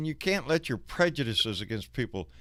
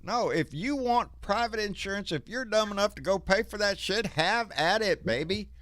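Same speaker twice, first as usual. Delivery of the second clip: heated; 8 semitones higher than usual. Real, not acted. Heated rant against private insurance.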